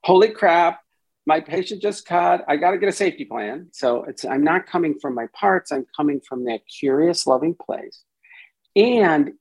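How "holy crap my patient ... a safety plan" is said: mock alarm. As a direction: panic like a frazzled therapist. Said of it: "as opposed to".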